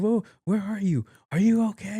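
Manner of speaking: high-pitched worried questioning; imitated alarmed mom. Mom hearing drunk talk on the call, mock alarmed. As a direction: fret in a high, put-on mother voice.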